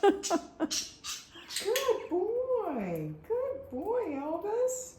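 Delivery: high sing-song praise. Real, not acted. Praising bird.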